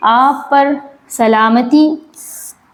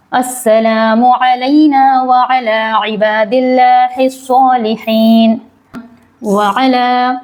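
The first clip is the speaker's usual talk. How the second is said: measured, drawn-out chanting of the tashahhud; slow Arabic recitation; much slower than usual. Acted. Teacher reciting the tashahhud slowly for students. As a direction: recite each word slowly and carefully.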